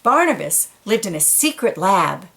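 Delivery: ordinary delivery, nothing marked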